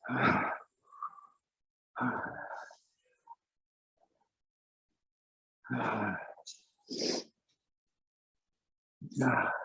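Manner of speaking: breathy grunts of effort between strokes; gasping while pushing hard; cycling hard. Real, not acted. Climbing hard during timed run.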